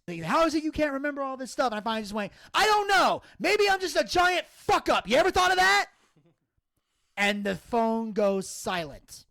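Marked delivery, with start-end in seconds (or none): 0.2-1.7 higher-pitched mock scolding; mild mock tirade; retold screaming. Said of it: "he's screaming at me". 2.5-5.9 high-pitched exasperated blowup; retold loss of temper, voice shooting up; snapped outburst. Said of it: "I just snapped".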